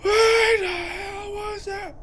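high, slow frightened whine; scared cry. Real, not acted. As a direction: whimper it out, rattled.

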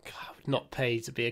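normal talking voice

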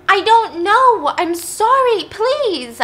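high, drawn-out begging cry; loud, high plea, acted distress; desperate pleading. Daughter begs dad to stop, acted desperate pleading.

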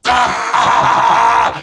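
big theatrical villain laugh; evil cackle. Acted. Villain gloats.

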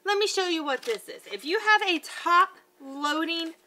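ordinary talk

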